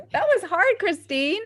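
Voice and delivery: high, loud laughing outburst; laughing protest. Real, not acted. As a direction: burst out in amused relief. Laughing relief after the word game.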